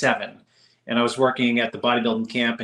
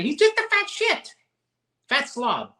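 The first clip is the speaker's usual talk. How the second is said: much higher mock Italian grandmother accent; a high, hammed-up Italian accent insult; imitating Italian grandmother; 12 semitones higher than usual. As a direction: squawk it like a scornful old Italian nonna. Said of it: "she used to just say".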